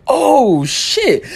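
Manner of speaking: high, startled yelp of dismay; shocked oh shit. Retold or imitated. Recalling gym class, just smashed a girl in the face: startled high yelp. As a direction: blurt it out, caught off guard.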